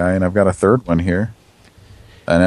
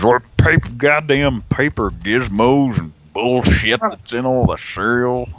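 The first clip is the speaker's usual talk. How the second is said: put-on slow, raised old grouch; grumpy old man voice; 4 semitones higher, slower than usual. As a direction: grumble it like a cranky old man. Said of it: "One where he says".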